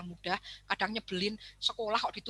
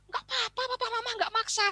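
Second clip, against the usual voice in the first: very high, sugary reassurance; sweet parent; 13 semitones higher than usual. Retold or imitated. Parent soothes child falsely.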